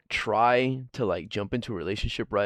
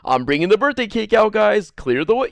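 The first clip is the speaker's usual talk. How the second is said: calling out loud, pitched up; loud announcement; 8 semitones higher, 7 dB louder than usual. Pretend. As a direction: announce it loudly to the room. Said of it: "I've never heard anybody say".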